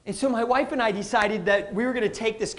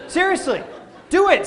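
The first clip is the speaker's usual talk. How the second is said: ordinary talk, nothing marked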